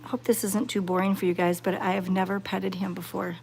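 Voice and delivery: ordinary delivery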